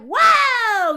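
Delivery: loud, high, drawn-out exclamation of shock; recalled shock, played up loud and high; shocked whoa. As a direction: let out a big, appalled "whoa". Retelling her shocked reaction.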